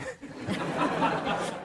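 a high, breathless burst of laughter; big breathy laugh. Real, not acted. Telling executives their forecast is nonsense, a high breathless laugh. Laughing at own retold quip.

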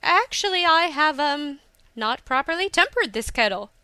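high, loud start, then easing down; flustered, faltering cover story; hesitant excuse. A narrator's reading. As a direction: stall awkwardly while making up an excuse. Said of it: "she hesitated".

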